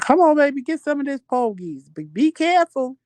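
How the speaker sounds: put-on sweetly coaxing the child to eat; great-grandmother voice. Great-grandmother offering porgies to the child, high coaxing voice.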